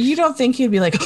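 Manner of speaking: usual speaking voice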